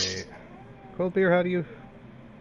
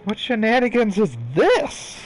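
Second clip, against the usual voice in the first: mock surprised; 8 semitones higher, 5 dB louder than usual. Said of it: "I'm like".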